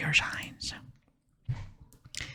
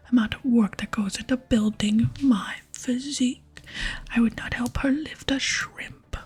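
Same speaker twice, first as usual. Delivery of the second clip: forceful stage whisper with voiced swells; vain, offended barbarian; 3 semitones higher, 4 dB louder than usual. Barbarian defends his physique to a visitor, offended stage whisper. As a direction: play a strongman's wounded pride.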